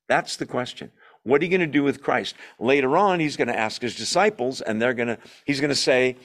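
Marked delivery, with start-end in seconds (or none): none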